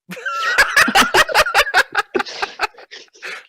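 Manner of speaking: a long high shriek of laughter. Real, not acted. Cracking up at the hell joke, shrieking laugh. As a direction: cackle up high and let it fall.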